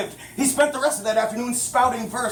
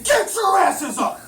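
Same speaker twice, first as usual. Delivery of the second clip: shouting; 9 semitones higher, slower than usual. Acted. Man waking them harshly.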